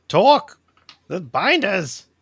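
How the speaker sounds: high, urgent in-character yell. Acted. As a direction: shout to a companion for help. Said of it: "I'll just yell out".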